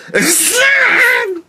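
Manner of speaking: a sudden high cackle, caught off guard; high burst of laughter. Real, not acted. Laughing hard at his own Trump bit. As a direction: break into a high-pitched laugh.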